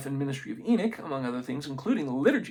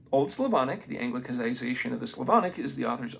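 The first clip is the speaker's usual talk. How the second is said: ordinary talk, nothing marked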